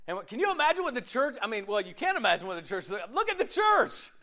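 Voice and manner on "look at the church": high-pitched, incredulous outburst; mock exclamation. Preacher pointing out the offended church, incredulous. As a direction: exclaim it high, as if it's obvious.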